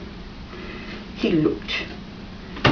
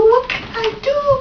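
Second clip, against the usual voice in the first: crow crying out; 13 semitones higher than usual. Acted. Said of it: "cried Crow".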